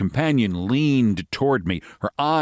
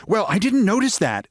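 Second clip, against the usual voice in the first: American lady voice; 11 semitones higher than usual. Acted.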